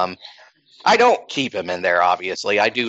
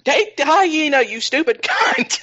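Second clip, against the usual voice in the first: mock scream. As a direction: scream it at the TV in a squeezed, high voice. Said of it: "grab the T V screen and scream".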